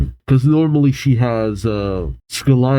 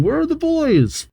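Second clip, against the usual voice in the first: much higher, acted panicky question; panicked. A narrator's reading. Girl panicking as her teammates are missing, acted panic. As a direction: pitch leaps up in a mock panic. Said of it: "starts to panic".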